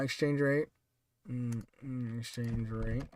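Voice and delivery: very low, hushed mumble; muttering to oneself. Real, not acted. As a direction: murmur it absently while looking something up. Mutters to self while looking it up.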